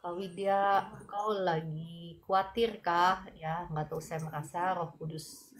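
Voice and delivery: soft, tender prayer against fear; calm the worried one softly as you pray; gentle prayer. Acted. Prayer for an anxious viewer. (doa lembut yang menenangkan)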